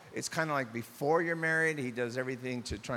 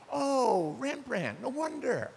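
awed admiration; 10 semitones higher, slower than usual. Acted. Admiring a painting in a museum.

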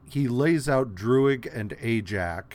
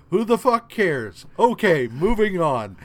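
higher and louder brush-off; mock dismissive shout; 7 semitones higher, 8 dB louder than usual. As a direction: wave it away loudly and move on. Dismissive retold reaction.

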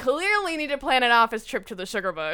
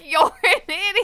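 higher, louder playful yell; mock shout back; 8 semitones higher, 8 dB louder than usual. Partner shouting back the expected reply, playful mock yell. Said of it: "expects me to shout back".

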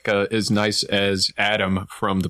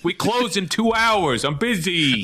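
rude manager voice; 8 semitones higher than usual. Pretend. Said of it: "the planetarium manager's like".

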